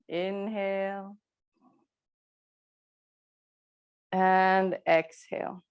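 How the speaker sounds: slow, stretched inhale and exhale cues; drawn-out breath cue. Real, not acted. Yoga teacher timing the class's breathing, slow and drawn out. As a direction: pace each word to a long breath.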